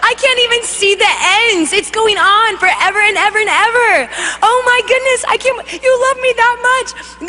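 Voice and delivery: high, breathless-with-wonder gushing; overjoyed amazement. Retold or imitated. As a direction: gush it out, acting overwhelmed with happiness. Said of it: "so full of joy".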